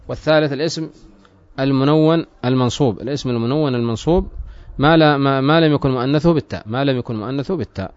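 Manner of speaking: ordinary delivery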